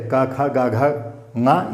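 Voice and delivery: का, खा, गा, घा, ङा said one by one from the throat; sounding out letters. Shown as a demonstration. Teacher reciting the क row for students, letter by letter. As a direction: spell out का, खा, गा, घा, ङा slowly and clearly. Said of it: "ये भी कंठ से उच्चारण होते हैं".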